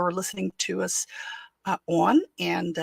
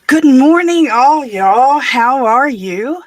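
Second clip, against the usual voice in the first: big, loud, high call to everyone; hearty high shouted good morning; loud greeting; 7 semitones higher, 15 dB louder, slower than usual. Real, not acted. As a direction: call out hello across the room. Calls out greeting to viewers.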